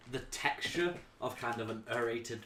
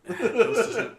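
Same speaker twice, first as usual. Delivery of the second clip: loud, higher laugh through the words; 12 semitones higher, 13 dB louder than usual. Real, not acted. Game master to players about the strange ale, laughing loudly. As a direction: burst out laughing as you agree.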